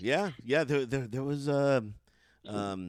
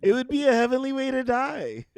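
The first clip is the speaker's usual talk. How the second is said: higher, dreamy rapture; swooning; 7 semitones higher than usual. Retold or imitated. Fan gushing to a friend about dying in his arms, swooning. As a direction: melt at the thought. Swooning over Vin Scully.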